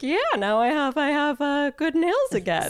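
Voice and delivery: high, giddy mock triumph; playful, pleased crowing with laughter near; retold triumph. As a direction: celebrate a small win, pitched well up. Retold triumph after secret self manicure.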